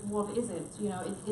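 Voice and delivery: ordinary talk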